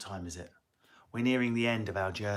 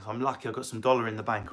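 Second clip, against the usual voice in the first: ordinary talk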